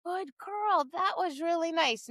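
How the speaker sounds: soft, gentle praise at an easy pace; calm praise. Shown as a demonstration. Trainer calmly praising a dog, calm and soft. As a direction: quietly reassure the dog. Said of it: "you just calmly saying".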